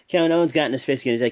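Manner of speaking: ordinary talk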